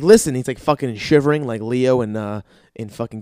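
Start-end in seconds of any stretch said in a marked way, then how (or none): 0.0-0.3 loud snap for attention; sharp call. Retold or imitated. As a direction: bark it out once. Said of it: "I'm like".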